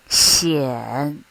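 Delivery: Xian dipping low, then rising; third tone. Shown as a demonstration. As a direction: drop Xian low and lift it. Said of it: "this is how we mark the tones".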